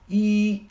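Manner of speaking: "一" held high and loud through clenched teeth. Shown as a demonstration. Coach showing the articulation, "一" said with teeth clenched. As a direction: bite down hard on a loud, high "一". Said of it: "它的动作是咬牙切齿".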